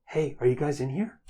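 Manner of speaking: mimicking Hero's call through the room; a light copy of Hero calling out for his friends. Bird mimics Hero calling to the party, lightly voiced. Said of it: "In Hero's voice".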